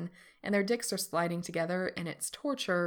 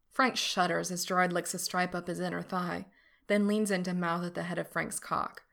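plain reading voice

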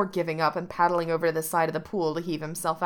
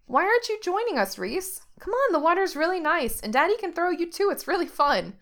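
put-on high, eager little girl voice; a small daughter begging her new friend to join; high childlike pleading; 11 semitones higher, 3 dB louder than usual. A narrator's reading.

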